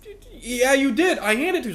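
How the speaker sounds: high, loud mock protest; exasperated insistence. Student insisting to the lying classmate, high and pushed. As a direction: insist like a frustrated kid. Arguing with the liar over card.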